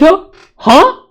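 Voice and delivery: high incredulous huh; mock disbelief. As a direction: scoff in fake shock. Mocking disbelief at Radagon.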